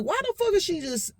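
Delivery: high, baffled question; puzzled. Retold or imitated. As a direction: wonder why she says this. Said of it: "And I'm like".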